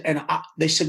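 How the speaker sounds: normal talking voice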